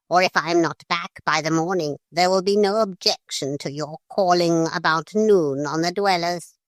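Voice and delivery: lightly voiced, dignified parrot; calm, superior bird. A narrator's reading. Parrot calmly promising Philip to remove the intruder.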